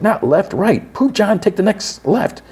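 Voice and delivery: high-pitched, exasperated directions; mock urgency. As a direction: imitate a frantic navigator, pitch way up. Quiet corrects John's driving.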